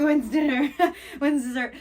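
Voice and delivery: put-on giddy child begging for dessert, laughing; excited kid. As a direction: squeal it like a kid who can't wait for dessert.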